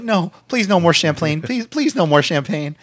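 high, begging imitation; mock pleading. Anderson begs friends to stop pouring, mock pleading. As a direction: beg them off in a high voice. Mimics Anderson refusing more champagne.

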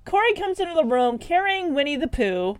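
normal talking voice

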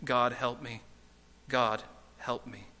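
low, soft, faltering plea; understated whimpering prayer; mild whimper. A narrator's reading. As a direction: whimper it quietly, slowing down. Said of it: "he ended up whimpering".

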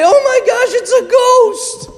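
high, slow mock-frightened cry; mock fright. Disciples in the upper room, high mock fright. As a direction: act spooked by a ghost. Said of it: "And they say".

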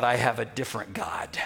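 breathy whispered aside, low and soft. Retold or imitated. Naaman inwardly refusing the foreign god, whispered. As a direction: whisper it like a secret confession. Preacher voicing Naaman's silent claim.

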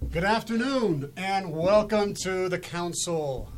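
bright, high, loud radio host welcome; chipper 1950s ad. Acted. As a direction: greet listeners like an old-time announcer. Host opening the radio show.

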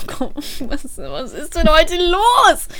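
dragging baffled what's going on today; exasperated disbelief. Retold or imitated. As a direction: stretch it out in disbelief. Inner exasperated thought.

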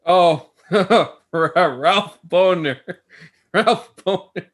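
real laughter breaking up the name; laughing. Real, not acted. Co-host reacting to the silly name reveal, laughing. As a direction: crack up while saying it, drawn out.

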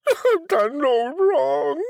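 high, slow pleading; pleading girl. A narrator's reading. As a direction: beg slowly in a high voice. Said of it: "She cried again".

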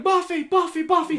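high calling out a dog's name. Retold or imitated. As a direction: call the dog down the street. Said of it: "is running out yelling".

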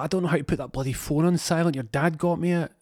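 lightly acted exasperated reply; mock exasperation. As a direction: pitch it up, flustered by the phone. Acted exasperated mum answering son.

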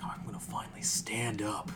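breathy mock-earnest whisper of a movie hero; mocking movie kid. Mocking heroic kids from movies, whispered. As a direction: whisper it like a cheesy film kid taking a stand.

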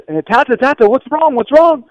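put-on high, alarmed child's cry of what's wrong; frightened boy. Scared boy calls to his father on the floor, high and alarmed. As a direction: call out in fear like a scared boy.

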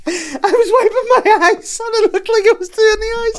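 high, loud words bursting through laughter; squealing with real laughter as you explain; helpless laughing protest. Real, not acted. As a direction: protest your innocence while cracking up. Denying it while cracking up.

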